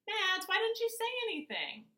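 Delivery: high, loud alarmed question. A narrator's reading. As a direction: ask in sudden alarm. Dad alarmed about missing dog.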